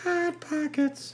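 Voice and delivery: high, slow comic drawl; Jim Gaffigan impression. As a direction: stretch it out in a high silly voice. Riffing on Jim Gaffigan's bit.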